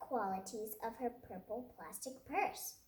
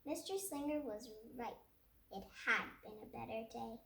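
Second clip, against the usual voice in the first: ordinary delivery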